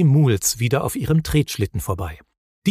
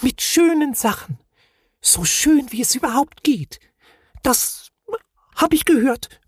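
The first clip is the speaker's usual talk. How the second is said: very high, timid small voice; blushing Knütt, high and shy; shy little creature; 12 semitones higher than usual. A narrator's reading. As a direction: speak up bashfully. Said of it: "errötete heftig und flüsterte".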